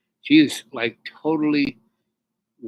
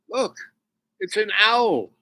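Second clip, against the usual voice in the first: much higher, amazed "Look, it's an owl"; excited call; 9 semitones higher, 4 dB louder than usual. Retold or imitated. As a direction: point up in wonder, high. Indian spotting him in tree.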